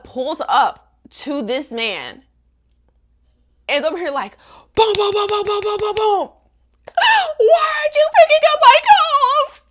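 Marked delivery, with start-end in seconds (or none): 4.8-6.3 high, rapid vocal booms; rhythmic mock banging noise; imitated door pounding. 7.4-9.6 high, loud screamed demand; hammed-up screaming through the door; hysterical mock shriek. Frantic girl at his door.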